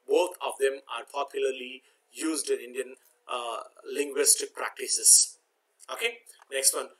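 normal talking voice

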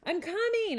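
loud, high call back. A narrator's reading. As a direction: shout back on the way. Calling back downstairs.

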